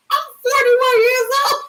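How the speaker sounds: high, indignant whine; indignant protest. Retold or imitated. Daughter protesting to her mother, high indignant whine. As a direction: protest like a grown woman treated as a kid. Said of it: "I'm like".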